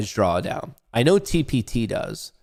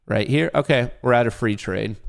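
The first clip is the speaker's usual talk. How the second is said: ordinary talk, nothing marked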